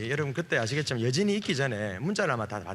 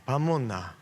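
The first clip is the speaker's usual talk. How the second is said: Gyeongsang dialect; slower than usual. Retold or imitated. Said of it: "제가 경상도 출신인데".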